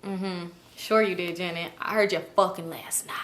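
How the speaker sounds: sly, hushed sarcasm; sarcastic murmur. Retold or imitated. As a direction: call out the lie under your breath. Sarcastic reply to Janet's excuse.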